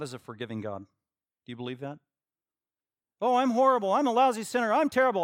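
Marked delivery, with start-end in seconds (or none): 3.2-5.2 loud, high, exaggerated confession; over-the-top mock despair; mock self-loathing sinner. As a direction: wail it out like a hammed-up penitent. Acts out a sinner confessing to pastor.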